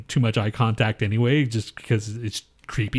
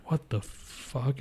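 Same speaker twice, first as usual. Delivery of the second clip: slow, much softer, stunned whisper-like curse; stunned disbelief; 2 semitones higher, 9 dB quieter, much slower than usual. Retold or imitated. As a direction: let it fall out of you, stunned and slow. Retold shock at reading.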